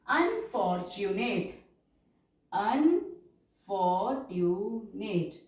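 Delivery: unfortunate stretched out syllable by syllable; slow, drawn-out shorthand dictation. Shown as a demonstration. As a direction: drag each word for the writers. Dictating with long drawn vowels.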